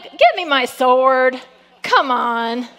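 loud, slow call to arms; mock battle cry. Preacher imagining himself as Elijah facing Jezebel, bold mock battle cry. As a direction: call boldly for your weapon.

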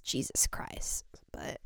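breathy whispered aside. Real, not acted. Muttering to herself mid promo, whispered aside. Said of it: "why do I make everything so serious".

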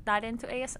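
normal talking voice

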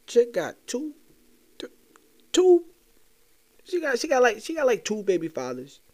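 high, loud disbelief building to a stammer; stunned, stammering exclamation; shocked outburst. Real, not acted. Shocked outburst about baby fathers.

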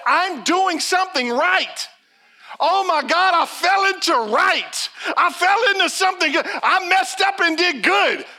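high, loud, amazed inner voice; excited disbelief. Retold or imitated. Mary amazed she got it right, excited. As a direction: gush it out like Mary can't believe it.

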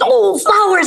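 put-on high delighted kid voice; light up like a child seeing flowers; excited little boy. Boy told to draw flowers, high delighted gasp. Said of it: "He goes".

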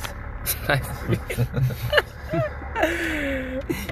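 high, drawn-out laughter; long laughing fit. Real, not acted. Host reacting to spicy beans title, long laugh. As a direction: crack up and keep laughing. Silly episode title suggested.

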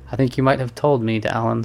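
usual speaking voice

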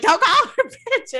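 high, loud, laughing imitation; mocking Candace, high and laughing; laughing mock protest. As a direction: squeal it through a laugh. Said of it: "Candace's like".

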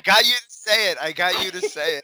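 gleeful teasing through laughter; real laughing tease. Real, not acted. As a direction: gloat while cracking up. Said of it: "laughing while teasing".

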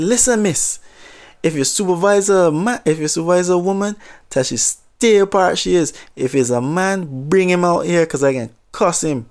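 put-on retelling a hot-headed Jamaican customer at the counter, in Jamaican accent. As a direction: imitate an angry Jamaican customer in a light Jamaican accent. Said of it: "maybe I'll just try the accent just a little bit".

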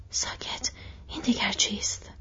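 soft, tense hush to a companion; hushed alarm. A narrator's reading. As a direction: drop the voice, alert to danger. Hobbits hiding, hearing a noise.

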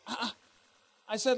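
ordinary talk

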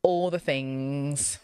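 slow drawn-out All the things; like Amanda. Retold or imitated. Mimicking Amanda's catchphrase, very slow. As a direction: stretch All the things like your friend. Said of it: "say it like Amanda".

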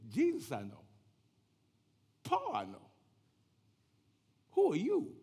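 put-on spirit voice jumping high; long pauses, climbing to a high, loud question; evil spirit. Evil spirit taunting the would-be exorcists, eerie challenge. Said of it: "The evil spirit said".

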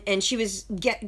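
ordinary delivery

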